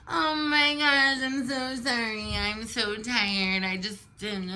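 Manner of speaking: high, slow, drawn-out fake sorry; hammed-up flaky friend apologizing; mock whiny apology. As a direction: overplay her sorry excuse.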